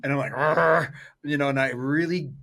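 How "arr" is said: short loud growl of annoyance. Retold or imitated. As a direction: growl at what you hear. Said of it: "I growl when I hear that".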